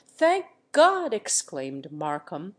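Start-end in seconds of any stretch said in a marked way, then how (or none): 0.1-1.1 second thank God cried out higher and louder; fervent, rising cry. A narrator's reading. Markham, hearing of Harriet's innocence, cries out fervently. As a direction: let the relief burst out. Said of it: "fervently clasping his hands together".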